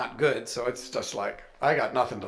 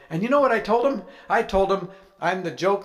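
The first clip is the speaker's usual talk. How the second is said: higher, louder, bursting with news; excited retelling; 6 semitones higher, 5 dB louder, faster than usual. Retold or imitated. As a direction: blurt it out to your wife. Excitedly telling Kim.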